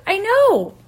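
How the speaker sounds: high, loud retold exclamation; emphatic agreement. Homeowner agreeing she could've been kidnapped, emphatic. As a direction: burst out in agreement, acted. Acted alarm after the scare.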